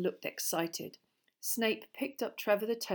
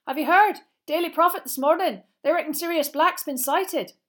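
high, loud, excited schoolboy; Seamus Finnegan; 10 semitones higher, 12 dB louder than usual. Acted. Seamus tells Harry of the sighting, excited. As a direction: spill big news in a high, loud voice. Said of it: "who looked excited".

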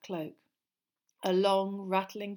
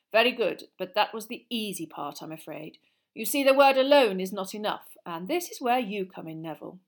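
lively, louder classroom lecture; lightly voiced teacher; 9 dB louder than usual. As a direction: project like an encouraging teacher. Voices teacher encouraging pupil.

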